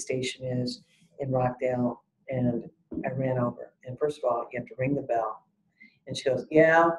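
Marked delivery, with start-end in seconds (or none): 6.5-6.9 loud, abrupt "what do you want" answer; curt unwelcoming answer. Retold or imitated. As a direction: imitate an unhelpful desk officer answering the bell. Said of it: "I'm irritated already. Not, 'How can I help you?'".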